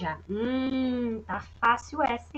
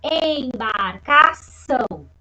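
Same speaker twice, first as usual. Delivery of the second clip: embarcação read slowly, syllable by syllable; teacher reading a word from the exercise slowly; 6 dB louder, much slower than usual. Shown as a demonstration. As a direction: draw the word out for the kids. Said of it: "você vai pegar a palavra".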